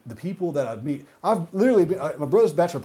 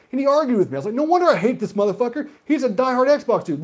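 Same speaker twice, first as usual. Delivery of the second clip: worked-up rant; 9 semitones higher, 4 dB louder, faster than usual. Real, not acted. Heated retelling of argument.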